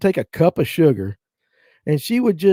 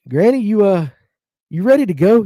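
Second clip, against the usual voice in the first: higher and louder, asking gently; preacher gently asking; 4 semitones higher, 5 dB louder than usual. Retold or imitated. Preacher brother asking dying granny if she's ready, higher. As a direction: ease her toward the end. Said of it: "He goes".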